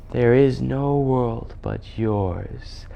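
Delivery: very slow, entranced echo; enchanted children. Acted. Prince and children echoing the witch, entranced. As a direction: repeat it drowsily as if under a spell.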